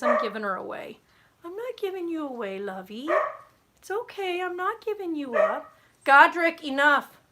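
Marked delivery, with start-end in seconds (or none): 1.4-5.7 puppy talk. Real, not acted. Owner soothing her anxious puppy, high cooing baby talk. 6.0-7.2 loud, sharp command at the barking dog; stern scold. Real, not acted. Owner telling the barking dog to stop, loud stern command.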